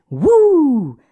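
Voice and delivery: loud high whoop of joy; cheer. Real, not acted. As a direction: whoop like the puck just went in. Cheering a tying goal.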